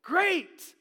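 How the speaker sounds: high, hammed-up casual shout; loud mock-delighted greeting; Peter. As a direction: call out with exaggerated enthusiasm, stretching the words. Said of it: "here is the way I viewed it".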